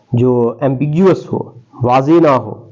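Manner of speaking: ordinary talk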